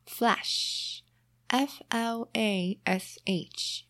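very slow spelled-out flash; slow spelling. Shown as a demonstration. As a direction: spell F L A S H slowly. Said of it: "spells slowly".

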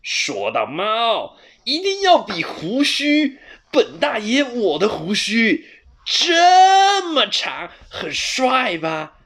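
slow, drawn-out high showing-off; proud cat. A narrator's reading. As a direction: stretch the words to show off the whiskers. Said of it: "球球得意地摸着自己的胡须". (得意的球球，拉长声音炫耀胡须)

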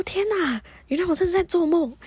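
high retold gasp of realisation; acted-out astonished discovery; amazed. Exclaims at discovering the dream.

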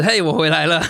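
laughing. Real, not acted. Host returns to show, laughing.

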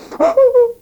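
a short, high mock sob; fake sob. Prince fakes a sob in front of the envoy, a hammed-up whimper. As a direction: break into a hammed-up little whimper. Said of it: "我就说就哭了".

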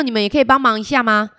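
ordinary delivery, nothing marked

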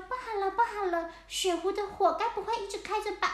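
high, fast little penguin panicking; alarmed little penguin. Acted. Little penguin fearing the fire was left on, high and fast. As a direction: rush out the worry in a high little voice.

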